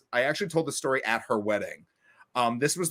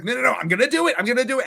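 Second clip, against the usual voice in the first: determined friend; 8 semitones higher, 3 dB louder, faster than usual. Retold or imitated. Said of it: "And she's like".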